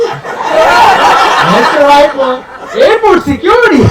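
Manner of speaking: laughing high and loud, drawn out. Real, not acted. Telling the crowd the answer, laughing. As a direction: crack up while saying it.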